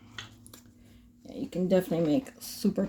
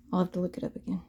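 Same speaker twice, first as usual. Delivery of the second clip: ordinary talk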